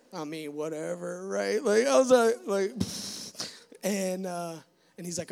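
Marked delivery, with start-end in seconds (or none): 2.8-3.5 unvoiced scoffing puff of air; a teen playing it cool, scoffing pfft; dismissive pfft. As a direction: blow off the question with a pfft. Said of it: "trying to play it hard".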